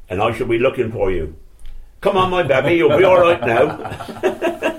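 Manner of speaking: high-pitched imitation of a protective team-mate; put-on high voice, warning the room, loud at the end; Bumper. Team-mate introducing the babby to opponents, high-pitched warning. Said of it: "And Bumper said".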